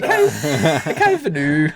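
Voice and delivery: slow, drawn-out mock Scottish, half laughing; attempted Scottish accent. Shown as a demonstration. Host trying again after being told it's Irish, laughing mock Scottish accent. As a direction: have a poor stab at a Scottish accent. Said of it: "Can you do a Scottish accent?".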